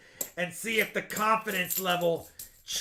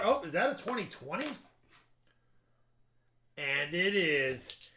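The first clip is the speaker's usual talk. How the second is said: ordinary delivery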